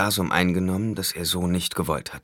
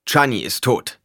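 forceful; 6 semitones higher, 6 dB louder than usual. A narrator's reading. Paul silences her.

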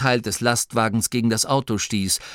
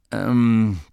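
timid, hesitant filler; shy; 4 dB louder than usual. A narrator's reading. Duke's son speaks up after the scolding, shyly. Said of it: "sagte der Sohn des Herzogs schüchtern".

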